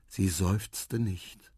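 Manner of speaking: usual speaking voice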